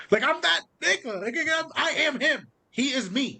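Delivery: high-pitched, hyped-up swagger; playful retold boast; mock boasting. Acting out the hero's boast.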